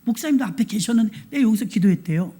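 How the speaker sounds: usual speaking voice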